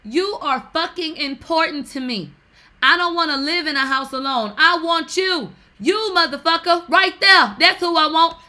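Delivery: high, loud, emphatic insistence; mock impassioned outburst; acted-out declaration. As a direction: proclaim it at full volume, hammed-up. Acts out public love declaration.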